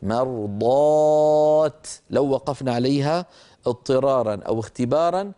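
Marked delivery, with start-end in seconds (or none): 0.0-1.9 مرضات held very slow, ending on a clear t; slow recited pausal مرضات; stopping on the open ta. Shown as a demonstration. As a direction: stretch مرضات and stop firmly on the t. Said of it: "فنقف عليها بالتاء المبسوطة".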